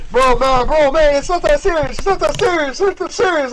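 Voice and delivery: high, loud protest; loud mock pleading. Teasing player tells angry man to calm down, mock pleading.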